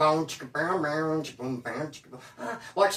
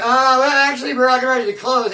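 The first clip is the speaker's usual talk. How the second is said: higher, louder, fake-polite brush-off; mock excuse; 5 semitones higher, 7 dB louder than usual. Stripper service mock-politely brushing off the lonely caller. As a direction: put on a bright phony voice.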